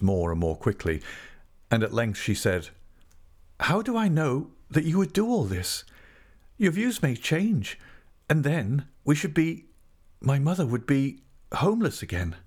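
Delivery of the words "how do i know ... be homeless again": put-on higher young woman's voice, doubting his promises; Tess, wary young woman. A narrator's reading. Tess doubts d'Urberville's offer of a home, higher, wary. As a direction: Tess pleading her doubts, voice lifted.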